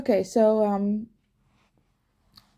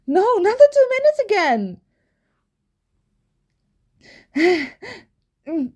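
panicked; 8 semitones higher, 6 dB louder than usual. Acted. Panicked rush to the shop.

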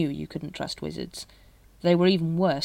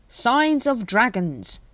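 slow, higher-pitched desperate finish; 6 semitones higher, 3 dB louder, much slower than usual. A narrator's reading. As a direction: spell it out slowly, a touch desperately. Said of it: "he finished desperately".

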